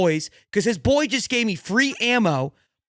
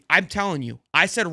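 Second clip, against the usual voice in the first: ordinary talk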